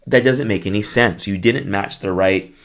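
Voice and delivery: ordinary talk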